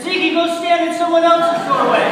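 very loud, high-pitched order; exasperated shout. Retold or imitated. Student to naked neighbor in his doorway, exasperated shout. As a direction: yell to send him away.

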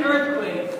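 loud, full-voiced retold scream. Wet neighbor running down the hallway, screaming. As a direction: imitate a panicked yell close by.

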